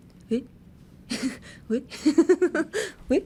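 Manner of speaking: a startled, rising え? that breaks into laughter; shocked rising huh; taken aback surprise. Shown as a demonstration. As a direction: caught off guard by an accusation. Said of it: "and you're like".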